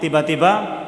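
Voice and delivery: usual speaking voice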